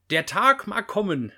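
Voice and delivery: loud, high, rousing battle speech; rally the troops with heroic emphasis; dramatic recitation. Acted. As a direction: declaim it grandly, building to the defiant turn. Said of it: "muss es auch gleich mit Betonung vorlesen".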